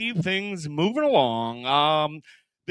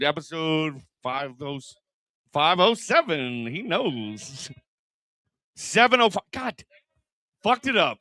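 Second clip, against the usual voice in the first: usual speaking voice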